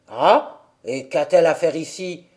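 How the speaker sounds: put-on low, surly old man's voice; gruff old man. A narrator's reading. Old man asks Dete why the child came, low and surly. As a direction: grumble like a hermit disturbed. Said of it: "demanda le vieux d'un ton bourru".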